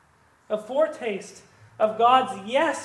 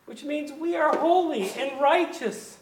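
rising fervor; 7 semitones higher, 4 dB louder than usual. Real, not acted. Preacher building to climax.

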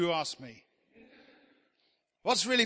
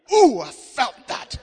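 mock emotional preacher; 6 semitones higher, 12 dB louder, slower than usual.

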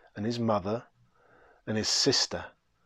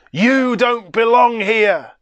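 much louder and higher, slow and heavy; booming verdict; 10 semitones higher, 8 dB louder, slower than usual. Acted. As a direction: hurl the words across the room. Bridegroom's verdict repeated.

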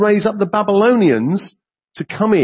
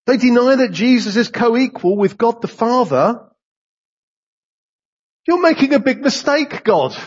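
much higher, incredulous protest to God; shocked protest; 8 semitones higher than usual. Retold or imitated. Believer protesting God's plan, high and incredulous. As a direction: object to God in disbelief. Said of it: "'Cause we would say".

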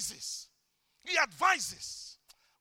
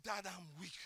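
prayer; 8 semitones lower, 16 dB quieter than usual. Shown as a demonstration. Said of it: "I said".

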